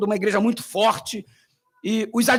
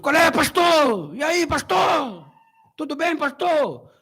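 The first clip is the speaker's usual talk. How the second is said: hearty pastor greeting; 6 semitones higher, slower than usual. Retold or imitated. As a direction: hail a fellow pastor with big cheer. Imitating pentecostal greeting, loud.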